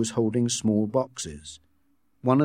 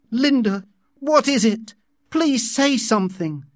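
high, loud alarmed plea; imploring; 12 semitones higher, 4 dB louder than usual. A narrator's reading. Savage begging dying Linda to speak, imploring. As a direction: beg urgently, pitch way up. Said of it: "the savage implored".

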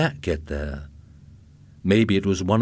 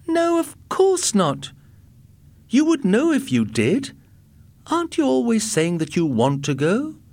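high-pitched, incredulous retort; exasperated wife; 4 dB louder than usual. A narrator's reading. Wife answers husband asking if he went to Mars, exasperated. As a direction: dismiss a silly question with high, exasperated emphasis.

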